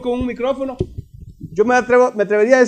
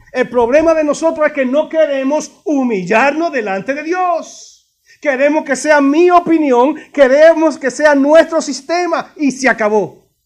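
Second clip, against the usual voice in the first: raised, emphatic pulpit voice; forceful preaching; 6 semitones higher, 6 dB louder, slower than usual. Real, not acted. Preacher confronting church over human pride, forceful preaching. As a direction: hammer out each charge to the congregation.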